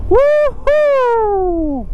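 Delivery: high, long whoop of delight; joyful whoop. Real, not acted. Vlogger whooping at the scenery, high long whoop. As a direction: let out a big happy whoop. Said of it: "just feel like shouting here".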